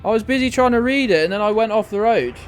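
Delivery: flustered outburst. Real, not acted. Streamer explaining the crash to chat, flustered and loud. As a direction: blurt it out still rattled.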